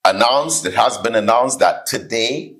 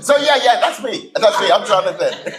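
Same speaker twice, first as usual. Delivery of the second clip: much higher, laughing fake answer; feigned recognition; 9 semitones higher than usual. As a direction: answer as if it's really your name. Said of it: "He's saying".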